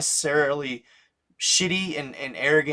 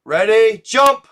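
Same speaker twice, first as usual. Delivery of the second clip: loud, higher shouted command; instructor's call; 7 semitones higher, 10 dB louder, slower than usual. Retold or imitated. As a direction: call out the jump signal. Bungee instructor calling the jump.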